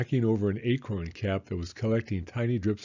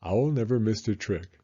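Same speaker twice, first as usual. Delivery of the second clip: ordinary delivery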